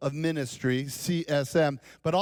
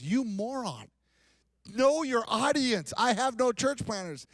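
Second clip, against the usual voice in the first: high, slow mock rebuke; mock scolding boss; 8 semitones higher than usual. Director texting the preacher he's a moron, high mock scold. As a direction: scold him like an exasperated boss, pitched way up.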